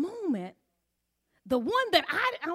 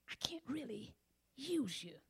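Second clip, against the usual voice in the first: whisper; 12 dB quieter than usual. Retold or imitated. God's voice drops to whisper.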